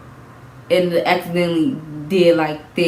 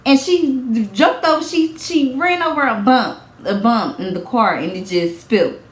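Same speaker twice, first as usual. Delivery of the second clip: imitating judge; 6 semitones higher than usual. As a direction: grill her like a skeptical judge. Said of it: "They gonna be like".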